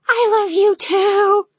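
put-on high, slow cooing baby talk. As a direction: coo it like a baby. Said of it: "Sierra coos in a baby voice".

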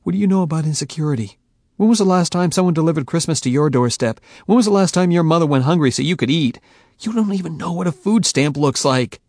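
loud, fast bitter retort; Michael, stung and angry; ignited anger. A narrator's reading. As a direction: fire back hard. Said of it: "The accusation ignited me".

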